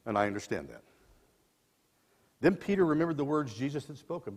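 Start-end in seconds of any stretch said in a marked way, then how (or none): none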